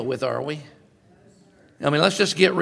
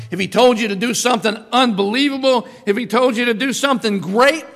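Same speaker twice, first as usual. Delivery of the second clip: much higher and louder, rising to a cry; preaching at full force; 9 semitones higher, 7 dB louder than usual. Acted. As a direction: drive the question home to the crowd.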